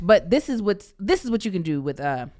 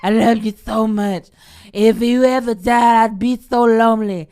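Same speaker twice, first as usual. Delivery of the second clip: put-on town drunk; 4 semitones higher, 9 dB louder than usual. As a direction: drag it out like a sloppy drunk. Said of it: "Why you sound like an old drunk".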